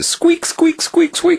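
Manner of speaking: very high-pitched mock mouse squeaks; mouse squeak imitation. Storyteller mimics the mouse his cat just pounced on, high squeaking. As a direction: squeak it out repeatedly, far above your own pitch. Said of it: "I heard this pounce, and the squeak".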